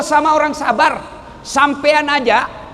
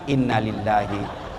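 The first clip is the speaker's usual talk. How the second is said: resigned sigh; 7 dB quieter, slower than usual. Shown as a demonstration. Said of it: "Balik kanan sambil jalan".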